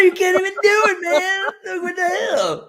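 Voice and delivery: mock crying over a friend who botched one letter; mock whiny exasperation. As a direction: wail it in mock despair.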